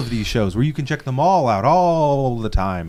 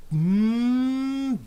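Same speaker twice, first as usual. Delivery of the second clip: appreciative hum; 11 semitones higher, 4 dB quieter than usual. Acted. Savoring beards.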